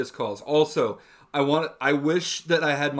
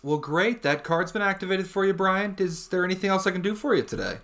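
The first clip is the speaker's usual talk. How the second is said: higher, upbeat call-centre sign-off; put-on polite phone professional; customer service voice; 5 semitones higher than usual. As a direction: play the eager-to-help support agent. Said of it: "hear my customer service voice".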